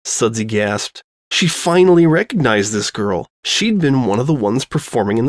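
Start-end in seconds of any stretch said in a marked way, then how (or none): none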